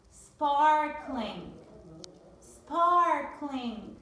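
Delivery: sparkling said loud and clear with a firm r; model word. Shown as a demonstration. Teacher to class, modeling sparkling loudly. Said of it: "Repeat after me".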